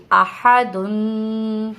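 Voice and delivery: دن ending in a long held nasal hum; do pesh sound. Shown as a demonstration. Teacher shows the do pesh sound, دن drawn out long. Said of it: "کس طرح سے ہم دو پیش کی آواز نکالیں گے".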